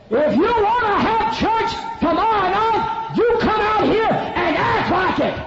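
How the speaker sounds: high, slow, full-force bellow; angry shouted rebuke. Retold or imitated. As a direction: holler after the departing crowd. Pastor berates departing crowd.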